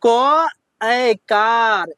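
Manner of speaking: each syllable drawn out slowly; a teacher spelling slowly for children; slow spelling demo. Shown as a demonstration. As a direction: sound out क ए कार very slowly for the class. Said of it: "इसको पढ़ना है".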